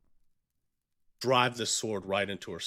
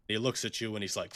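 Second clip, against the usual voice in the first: ordinary talk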